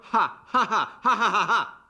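high, loud forced ha ha ha; bitter mock laugh. Husband mocks the joke with a high, loud forced laugh. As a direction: laugh sarcastically at the idea of a joke.

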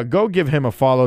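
ordinary talk, nothing marked

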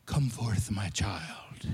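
hushed mock-tender Jesus call; mock soft-spoken Jesus. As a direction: breathe it like a gentle mock saint. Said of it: "People always think Jesus is this very soft-spoken".

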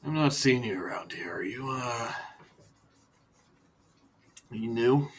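hushed, suspicious question; a captive eyeing a stranger; wary prisoner. Acted.

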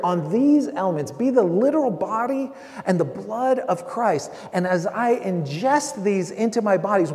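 preaching prayer at full force; fervent prayer. Acted. Preacher prays over the bread and cup, fervently. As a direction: pray it out over the elements. Prays the communion invocation aloud.

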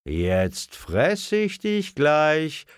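high, slow mock menace; playful threat. A narrator's reading. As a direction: draw it out like a pouncing monster. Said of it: "drohte Tante Lisa". (spielerisch drohend, hoch und gedehnt)

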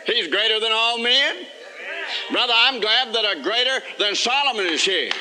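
preaching at full force. Acted. Preacher to his congregation, building a rousing litany. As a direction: preach it, build each "He's greater" toward the climax.

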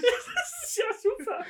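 high, laughing almost to tears; laughing hard. Real, not acted. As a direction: squeal it through laughter. Both laughing at matching gifts.

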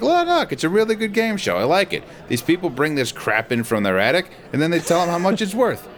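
very high, put-on dad voice; Martin. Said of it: "He was like".

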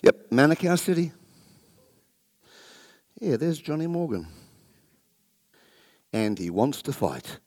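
low, amused deity voice; a giant god chuckling at the sheep. Pretend. God voice spots the pastor.

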